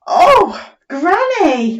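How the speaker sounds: high excited squeal then slow; squirrel voice. Acted. Teacher voicing the squirrel to Granny, squealing. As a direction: squeak it like a wide-eyed squirrel. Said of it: "The squirrel says".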